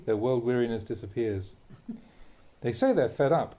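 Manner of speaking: ordinary delivery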